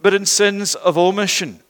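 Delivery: loud, emphatic sermon delivery; impassioned preaching. Real, not acted. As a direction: preach it out with weight and conviction. Preaches at full force.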